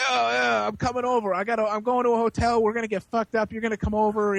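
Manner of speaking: imitating a hyped-up drunk on the phone; eager phone boasting. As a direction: rope someone into a party, playfully hammed up. Drunk man's eager phone call, retold.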